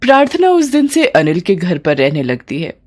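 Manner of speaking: ordinary delivery, nothing marked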